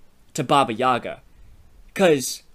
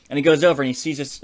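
usual speaking voice

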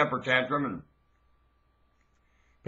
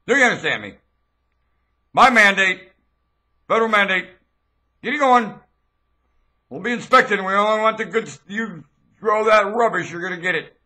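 higher, louder furious decree; shouted decree; 4 semitones higher, 4 dB louder, faster than usual. Acted. Self-declared king decreeing to growers, furious shouted decree. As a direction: hammer each order like a tyrant. Issuing federal mandate, threatening.

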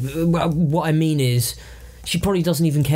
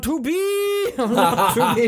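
mock Hamlet recital; 9 semitones higher, much slower than usual.

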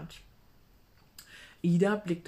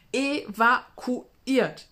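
stretched, loud and high evakuiert; milk the word like big news; breathless dramatic announcement; dramatic exclamation; 6 semitones higher, 9 dB louder, much slower than usual. Acted. Miriam dramatizing storm news.

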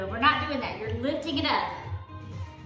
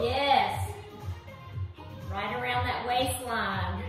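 normal talking voice

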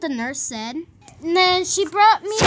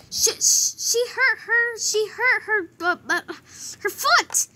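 higher, halting stammer; trying to slow down; 5 semitones higher than usual. Acted. Classmate retells the injury after being told to slow down, halting. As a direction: force the words out one piece at a time, still panicky. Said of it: "Slow down".

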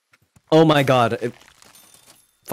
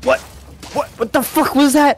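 high, loud disbelieving yell; can't believe what just happened; shocked outburst; 12 semitones higher, 4 dB louder than usual. Real, not acted. Shocked by opponent's team.

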